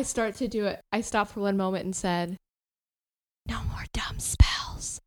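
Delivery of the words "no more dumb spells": slow unvoiced whisper. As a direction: hiss it slowly under breath. Whispered aside mid lockpicking.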